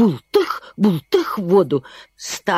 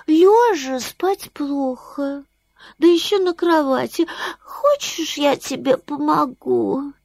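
a sleepy bat speaking sweetly; squeaky bat voice; 7 semitones higher than usual. Acted. Bat offers help.

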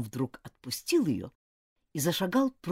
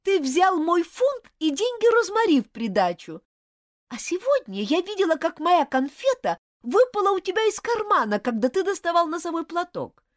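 angry accusation; 8 dB louder than usual. Acted. Girl accusing friend of stealing.

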